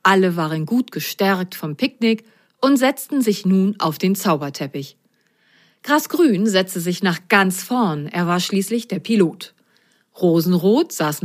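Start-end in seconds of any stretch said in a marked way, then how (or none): none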